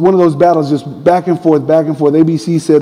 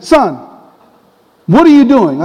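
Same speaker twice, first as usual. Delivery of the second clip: high, mock-stern fatherly reproach; scolding dad; 5 semitones higher than usual. Dad phoning his long-haired son, scolding. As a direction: play an exasperated father telling off his boy.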